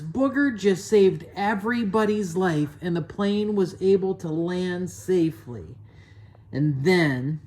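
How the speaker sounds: usual speaking voice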